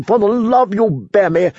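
high, pleading prisoner voice; desperate plea. A narrator's reading. Prisoner begging Sir Axel, high and desperate. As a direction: beg to be let go. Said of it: "the prisoner asked desperately".

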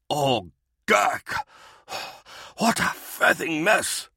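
slow, high, shaken stammer; stammering in shock. A narrator's reading. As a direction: stumble over the words, stunned. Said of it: "Dower stammered".

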